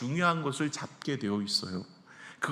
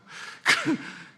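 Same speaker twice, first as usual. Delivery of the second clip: brief laugh. Real, not acted. Self-mocking joke about beauty.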